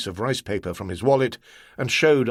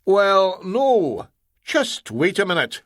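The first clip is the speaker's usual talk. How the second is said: higher, louder sly stalling; cunning; 8 semitones higher, 3 dB louder, slower than usual. Acted. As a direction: stall him slyly. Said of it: "McInnery said cunningly".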